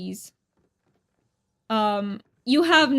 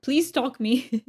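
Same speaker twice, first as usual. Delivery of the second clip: ordinary talk, nothing marked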